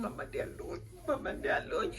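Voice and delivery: unvoiced pleading whisper; whispered plea. Whispering to her mom that the pains hurt. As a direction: breathe the words out like a hurting plea. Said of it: "my facial expression".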